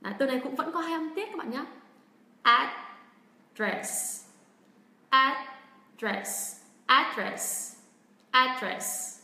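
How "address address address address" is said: "Address" as AD-dress, strong first syllable, second dropping away; stress on first syllable. Shown as a demonstration. Teacher models the American way for learners, first-syllable stress on "Address". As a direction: punch the "Ad" of "Address", let "dress" fall. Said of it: "vào âm tiết thứ nhất".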